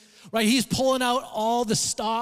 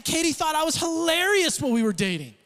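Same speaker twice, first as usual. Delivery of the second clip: normal talking voice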